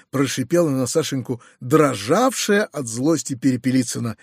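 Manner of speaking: usual speaking voice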